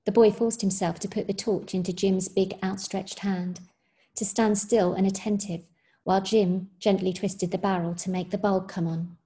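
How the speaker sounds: usual speaking voice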